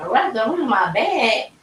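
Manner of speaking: slow, high disbelieving question; stunned echo. Retold or imitated. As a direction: repeat it back slowly in shock. Retold shocked echo to nurse.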